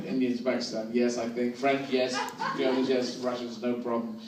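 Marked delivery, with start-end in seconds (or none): none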